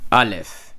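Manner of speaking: Aleph said slowly as AH-lef, falling at the end; Hebrew letter name. Shown as a demonstration. Teacher modelling Aleph for students, clear and slow. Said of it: "Notice how I'm saying it".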